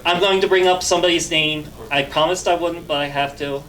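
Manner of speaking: usual speaking voice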